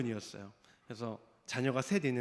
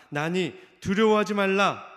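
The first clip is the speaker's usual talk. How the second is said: forceful preaching; 4 semitones higher, 9 dB louder than usual. Acted. Preaching climax, Jesus' words.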